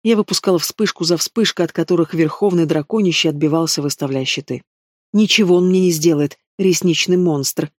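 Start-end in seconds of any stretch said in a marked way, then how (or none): none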